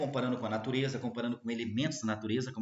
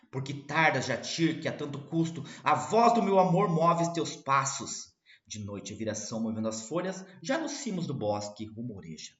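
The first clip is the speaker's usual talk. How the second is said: declaiming with rising force; poem recital; 2 semitones higher, 5 dB louder than usual. Acted. Teacher reciting Leito de Folhas Verdes, declaimed. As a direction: recite as a lover calling out.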